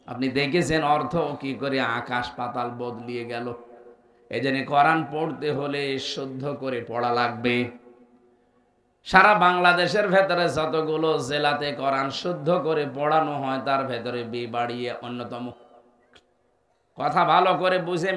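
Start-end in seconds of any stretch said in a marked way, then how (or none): none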